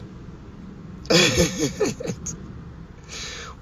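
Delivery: cracking up in a high laugh. Real, not acted. Man amused by his own teasing question, real high laugh.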